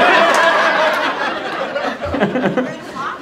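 laughing at the crowd's answers; high laugh. Real, not acted. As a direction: laugh in disbelief.

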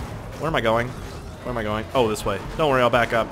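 ordinary delivery

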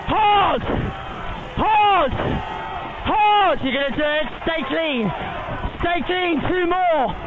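empty your voice into the last strokes; all-out shouting. Real, not acted. [name] screams final strokes home.

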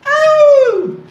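sharp high yelp of energy; high whoop. Real, not acted. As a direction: let out a loud high whoop. Coach whoops during workout.

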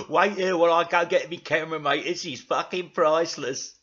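loud character voice after a laugh; gleeful, loud mockery; Dougal. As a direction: crow with delight at a mate. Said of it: "said Dougal".

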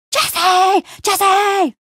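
high chant of a fan crowd; imitated cheering crowd. Playing the crowd cheering for Jesse, excited chant. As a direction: chant the name like wild fans. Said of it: "and the crowd goes wild".

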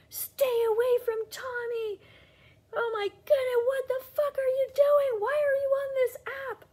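very high, loud mock outrage; hammed-up falsetto rant; mocking furious fans. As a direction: parody the hysterical attackers. Mocking angry duet reactors.

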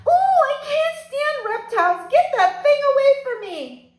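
high, loud shriek of disgust; shrieking lady. Acted. Guest recoiling from a turtle under her nose, shrieking high. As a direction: squeal it like a prim lady who hates reptiles. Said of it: "Missus Yarby shrieked".

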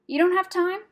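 high, loud incredulous echo; mocking disbelief. A narrator's reading. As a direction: throw it back teasingly. Brownie mocks rat's complaint.

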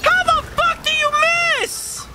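very high-pitched disbelieving yell; real gamer rage, voice shooting up; outraged shout. Real, not acted. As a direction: shriek it at the game in outrage. Raging at a lost game moment.

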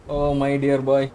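usual speaking voice

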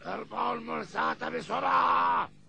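captain shouting to the crew; shouted order. Acted. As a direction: bark the order across the deck. Pirate captain orders anchor raised. (صراخ قبطان يأمر البحارة)

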